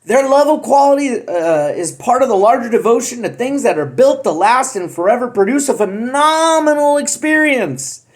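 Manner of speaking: normal talking voice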